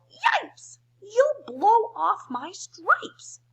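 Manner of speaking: high loud yelp; yelling. Acted. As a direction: yelp it out in alarm. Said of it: "The zebra yelled".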